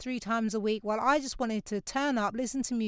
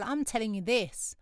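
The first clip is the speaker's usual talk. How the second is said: ordinary talk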